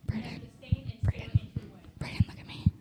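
hushed call across the room; whispering. Real, not acted. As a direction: whisper his name urgently. Whispering to get attention.

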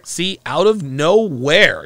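slow mocking drawl of "out of nowhere"; mocking her words. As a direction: stretch out "out of nowhere" to mock her. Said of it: "Do you see how she said that?".

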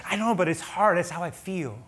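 high-pitched defensive plea, easing off at the end; pleading protest. Acted. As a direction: plead your case, exasperated. Acted plea back in argument.

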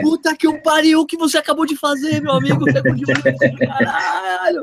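high, excited outburst at the record; amazed exclamation. Retold or imitated. Runner voiced in amazement at the Olympic record, high excited outburst.

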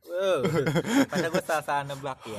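laughing. Real, not acted. Laughing at the name joke.

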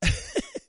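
quick high burst of laughter; real laugh at the clip; amused high laugh. Real, not acted.

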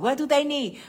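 loud, high, pressing question; insisting. Retold or imitated. Grandmother pressing her daughter about the gift list, loud. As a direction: push her for an answer.

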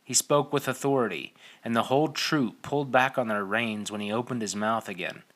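usual speaking voice

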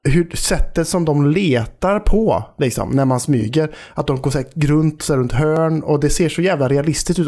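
ordinary delivery

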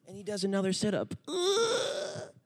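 high strained grunt with a cry; tired boy grunting through a sit-up; straining groan. Retold or imitated. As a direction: push out a sit-up effort groan.

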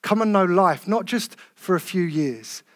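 soft, low, tender invitation. Real, not acted. Preacher gently inviting visitors to come to God, softly. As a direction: speak gently as if inviting someone home. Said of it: "with all tenderness in my heart".

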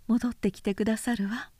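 a touch firmer, insisting; mildly insistent wife. A narrator's reading. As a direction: press it as a demand, not a question. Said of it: "と律は強い調子で言った".

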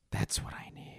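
whispered dry aside; sarcastic whisper. Real, not acted.

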